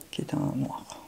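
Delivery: soft, hushed whisper. Real, not acted. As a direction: whisper it under your breath.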